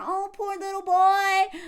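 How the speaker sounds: high, slow, mocking sympathy; sarcastic sing-song sympathy; mock pity. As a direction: coo it like you're pitying a little boy. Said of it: "they can weaponize our empathy".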